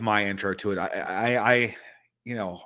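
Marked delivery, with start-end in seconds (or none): none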